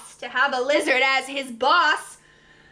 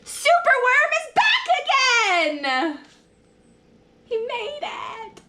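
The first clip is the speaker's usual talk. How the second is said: excited; 9 semitones higher than usual. Acted. Joyous return in story.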